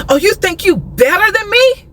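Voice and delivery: high-pitched indignant challenge, mimicked; retold pissed-off man. Woman imitating the rebuffed salesman taking offence, mock indignant. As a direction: mimic his offended question, pitch climbing at the end.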